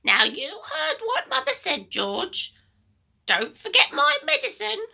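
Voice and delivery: put-on high, loud, bossy old woman; a mean grandma giving orders, high and loud; grumpy grandma voice. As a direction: nag the boy like a sour old granny.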